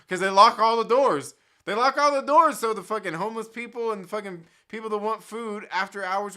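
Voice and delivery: loud, fired-up complaint; venting loud about the locked doors; heated rant. Real, not acted. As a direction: let it rip, indignant.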